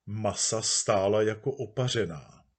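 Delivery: usual speaking voice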